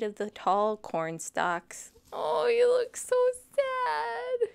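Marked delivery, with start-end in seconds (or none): 2.1-4.5 high, slow pretend sob; mock crying. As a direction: fake a weepy voice for the sad dragon. Reader pities sad dragon.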